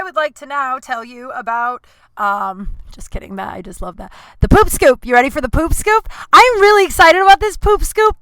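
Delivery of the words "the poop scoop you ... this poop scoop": excited. Real, not acted. Introduces gross story segment.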